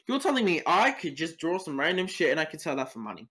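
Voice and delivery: usual speaking voice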